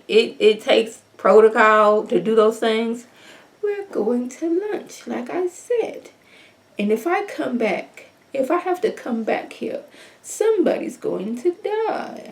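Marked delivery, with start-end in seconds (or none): 3.6-12.3 bougie mom voice. Retold or imitated. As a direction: calmly threaten her daughter. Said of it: "this bougie voice that the mom was giving".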